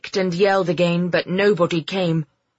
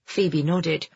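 usual speaking voice

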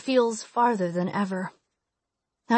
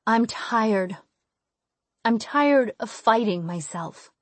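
plain reading voice

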